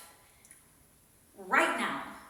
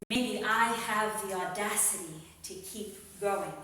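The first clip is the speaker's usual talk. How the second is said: slow, weighty poetry recital with pauses; spoken word poem; 3 semitones higher, 5 dB louder, slower than usual. Acted. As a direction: perform it like a slam poet.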